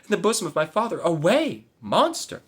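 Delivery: high, loud cries of horror; horrified cry. Acted. Maid horrified at the plan to kill her father, loud high cries. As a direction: recoil in horror, voice climbing. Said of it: "cried she with horror".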